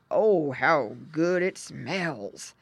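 drawn-out, lightly grunted pig voice; grunting pig. A narrator's reading. Father pig smells supper, lightly grunted. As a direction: savour it slowly with a hint of grunt. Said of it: "grunted Mister Pig".

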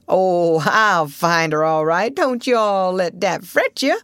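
drawn-out Southern drawl with amusement; chuckling old boatman. A narrator's reading. Old boatman promising to find the steamer, chuckling drawl. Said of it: "chuckled the negro".

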